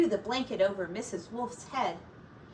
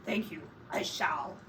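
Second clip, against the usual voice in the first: low sly whisper; whispering wolf; 5 semitones lower, 6 dB quieter than usual. Acted. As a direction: whisper it with hidden hunger. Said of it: "said the wolf".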